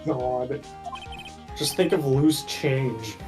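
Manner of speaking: usual speaking voice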